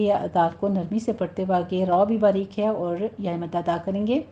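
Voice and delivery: ordinary delivery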